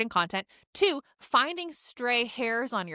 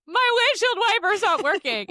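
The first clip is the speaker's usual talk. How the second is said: high, loud mock sob; whimpering through tears; retold crying; 9 semitones higher, 5 dB louder than usual.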